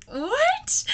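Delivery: high baffled what from the cute guy; confused what. Retold or imitated. Cute guy baffled by strange question, high confused what.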